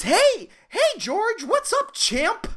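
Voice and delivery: high, hearty mock greeting; chummy coworker. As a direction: ham up the overly friendly coworker. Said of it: "he says".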